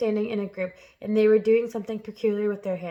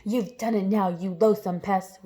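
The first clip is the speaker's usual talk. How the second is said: usual speaking voice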